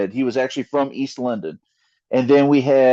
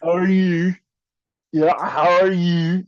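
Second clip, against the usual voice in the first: slow, high mock Cockney greeting; East London accent; 3 semitones higher, slower than usual. Shown as a demonstration. Showing the host his accent on request, mock Cockney. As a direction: stretch it out like a London bloke. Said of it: "I can do it with the accent".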